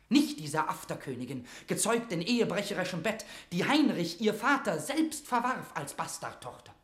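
heated, contemptuous tirade; scornful outburst. Acted. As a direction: spit out the insult with rising scorn. Mortimer rails against Elizabeth.

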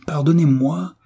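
s'exclamer avec indignation; hors de lui. A narrator's reading. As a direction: question outrée, haut perchée. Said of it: "s'écria Savelli hors de lui". (indigné, hors de soi)

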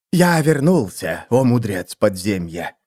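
plain reading voice

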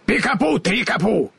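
high, excited scarecrow cry; exclaiming. A narrator's reading. Scarecrow exclaims his charm, excited. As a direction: burst out with the magic words. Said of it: "воскликнул Страшила".

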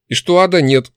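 loud, high heated demand. A narrator's reading. Demon adding a demand for the book, heated. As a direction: tack it on in hot haste. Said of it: "добавила она в запале".

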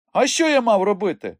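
high, loud, cheeky retort; defensive young rogue. A narrator's reading. Boy defending himself to uncle, defiant. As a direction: protest in a boy's high voice.

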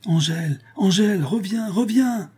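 high shouted call, rising each time. A narrator's reading. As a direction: call her back at full voice. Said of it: "en criant son nom".

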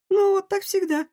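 put-on high, indignant girl voice; protest, offended and high. A narrator's reading. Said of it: "возмутилась Лив".

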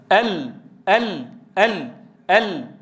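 "ال" drawn out slowly four times; slow repeated lam. Shown as a demonstration. Teacher demonstrating the lam closure, repeating "ال" slowly. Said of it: "ينغلق المخرج انغلاقا محكما".

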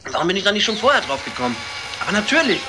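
hammed-up sudden insight; excited realization. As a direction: rattle it off as it dawns on you. Detective has a sudden realization.

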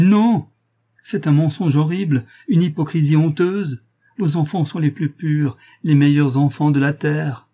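high, loud indignant protest; impassioned outcry. A narrator's reading. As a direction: cry out in fierce defence of the children. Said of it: "s'écria Gabriel".

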